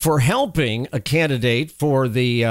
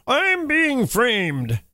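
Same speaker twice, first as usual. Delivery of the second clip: high, slow, whiny mock protest; hammed-up innocent whine; mocking politician quote; 10 semitones higher, much slower than usual. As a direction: parrot the excuse in a silly high voice. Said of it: "the same thing that they all do".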